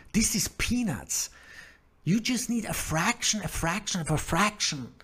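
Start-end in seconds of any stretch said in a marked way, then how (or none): 0.1-1.3 whispered aside. Real, not acted. Repeats it whispered, higher.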